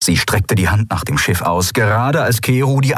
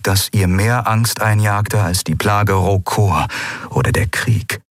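ordinary delivery

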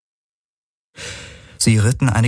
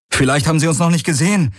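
calling out; 10 semitones higher, faster than usual. A narrator's reading. Passenger hopes the pursuers missed them, calling out. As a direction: call ahead fast, clinging to doubtful hope. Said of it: "rief Galen wider besseres Wissen".

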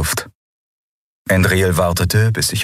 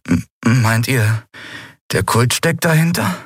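higher, halting, stammered start; hesitant question; 6 semitones higher than usual. Acted. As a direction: ask it uneasily, rising high at the end. Uneasy question after the frightening warning.

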